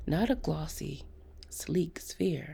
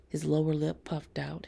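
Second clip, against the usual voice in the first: plain reading voice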